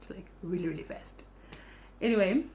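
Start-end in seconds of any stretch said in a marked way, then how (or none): 0.1-1.2 really fast fading into a breathy hush; teasing herself, fading to a whisper; trailing off to a whisper. Shown as a demonstration. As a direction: let the repeat die away to a whisper. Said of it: "you're gonna have to forgive me".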